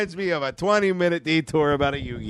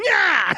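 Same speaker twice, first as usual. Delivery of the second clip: mock battle yell. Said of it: "so he just goes".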